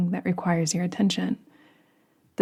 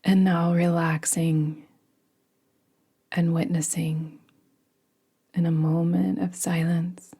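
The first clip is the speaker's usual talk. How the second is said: guided meditation. Acted. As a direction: ease them gently into rest. Leads a yoga nidra meditation.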